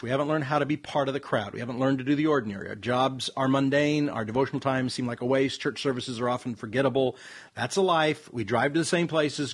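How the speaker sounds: low, even read-aloud voice; unenthusiastic article reading. Real, not acted. Cohost reads an article that depresses him, low and unenthusiastic. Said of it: "got that out of your tone of voice".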